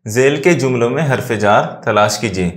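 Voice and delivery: usual speaking voice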